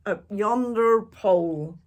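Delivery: loud crew chant; shouted echo. A narrator's reading. Pirate crew echoing Braidbeard, loud shout. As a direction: bellow it together. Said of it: "the others shouted".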